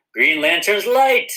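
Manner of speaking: high, loud, drawn-out final cry; rousing oath climax. Pretend. Testing the ring with the kids, rousing climax of the oath. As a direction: call it out big, stretching each word to power up the ring.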